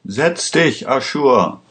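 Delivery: high-pitched command at normal volume; understated shout, starting high; narrated shouted order. A narrator's reading. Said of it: "schrie Pandora".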